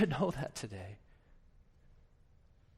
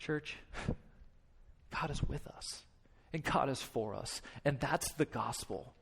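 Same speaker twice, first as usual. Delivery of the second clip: softer and lower, slow and tender; soft reassurance; 5 semitones lower, 11 dB quieter, slower than usual. Real, not acted. Pastor softly reassuring the congregation that God is with them. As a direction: hush down and comfort the church. Quiet comfort to church.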